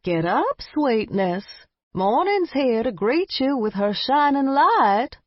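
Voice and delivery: high, lilting granny voice; a sweet old grandmother waking a child. Acted. Grandmother wake-up call.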